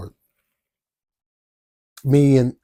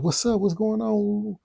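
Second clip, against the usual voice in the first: lightly voiced woman, much higher and soft; concerned friend; 9 semitones higher, 5 dB quieter than usual. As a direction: ask gently like the worried friend. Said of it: "She like".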